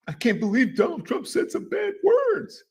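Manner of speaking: high-pitched pretend outrage; mock shock. Host mocking people shocked by bad words, mock shock.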